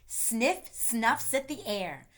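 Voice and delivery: plain reading voice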